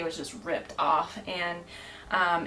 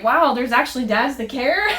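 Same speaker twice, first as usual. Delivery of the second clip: laughing; 6 semitones higher, 9 dB louder than usual. Real, not acted. Delighted surprise at the dads.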